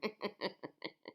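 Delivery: high sinister cackle; high crone laugh; witch cackle. Acted. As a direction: laugh in evil triumph. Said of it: "with a sinister laugh".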